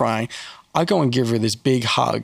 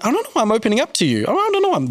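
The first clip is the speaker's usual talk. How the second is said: imitating a stranger, much higher and fast, baffled at opening up; lightly mocked gushing stranger, rising to a squeak; amazed stranger; 8 semitones higher, faster than usual. Stranger at the park confides, fast, high and baffled. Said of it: "they're, they're like".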